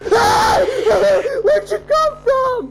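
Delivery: very high, loud drawn-out shout; shocked scream. Real, not acted. Player surprised by the killer, shocked scream. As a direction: shriek at a sudden ambush. Jumpscare in horror game.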